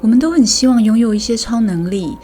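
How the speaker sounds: usual speaking voice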